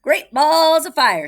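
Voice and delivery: high, loud, drawn-out cry; cried out. Acted. Goose crying out at the perfect nest, high and loud. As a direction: goose hollers in amazement. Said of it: "she cried".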